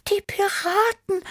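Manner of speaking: very high startled mew; scared little cat voice; frightened Tibi. A narrator's reading. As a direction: mew it in high-pitched alarm. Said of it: "miaute Tibi erschrocken".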